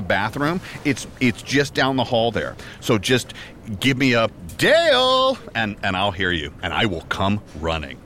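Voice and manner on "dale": high sing-song call of a name; calling out Dale. Acted. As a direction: call his name like summoning him. Calling out his name to be summoned.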